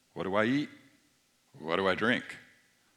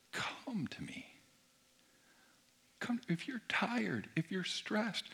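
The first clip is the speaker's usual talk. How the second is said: higher, whispered and soft invitation; gentle whisper; 6 semitones higher, 8 dB quieter than usual. Acted. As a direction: coax the weary in a whisper. Voicing Jesus' invitation to the tired.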